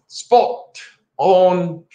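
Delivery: high, drawn-out Australian-English attempt; playful try at an Australian English accent. Shown as a demonstration. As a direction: stretch out both words in a put-on Australian English accent. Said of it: "working on my Australian English thing".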